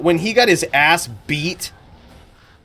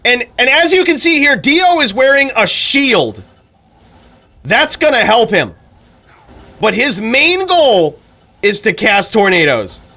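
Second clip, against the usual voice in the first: higher, louder hyped commentator voice; Daniel Cormier impression; 8 semitones higher, 5 dB louder than usual. Streamer imitating DC calling a game duel, excited and loud. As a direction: hype every move like an excited fight announcer. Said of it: "That's D C".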